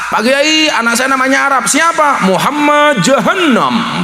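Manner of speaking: high, proud parent voice, last name slowed; eager parent. Acted. Proud father telling the kiai his son's name, high and eager.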